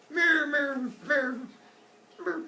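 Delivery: high, mock cow bleats; cartoon cow death cries; dying cow noise. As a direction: imitate a cow crying out, petering out. Imitates the sacrificed cow.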